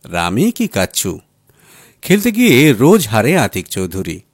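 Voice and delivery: plain reading voice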